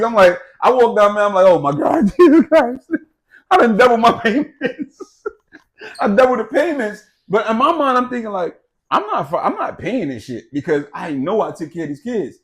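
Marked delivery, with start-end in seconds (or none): 1.5-7.0 loud, high laughing through the words; laughing in disbelief. Real, not acted. Husband recalling the doubled payments, laughing loudly.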